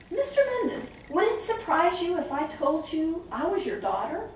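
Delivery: high, coaxing, playful tone; gentle sing-song question. Retold or imitated. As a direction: coax him with a lilting voice. Teasing father off stage.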